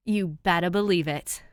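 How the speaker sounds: loud, eager and grinning. Acted. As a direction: punch it with glee. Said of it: "Danielle grinned".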